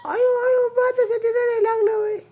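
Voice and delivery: high, playful mock sympathy. Real, not acted. Teases child crying for rice.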